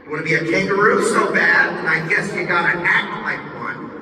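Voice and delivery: lightly voiced, sarcastic announcer; mocking system AI. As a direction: tease the kangaroo, fake-sorry. System AI mocks the crawler.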